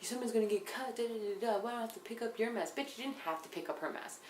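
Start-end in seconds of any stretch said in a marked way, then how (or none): 0.0-2.7 higher, fussy nagging mock voice; mocking uptight Alicia. Mimicking Alicia nagging about the glass, higher and fussy. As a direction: nag like a prissy housemate. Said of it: "is like".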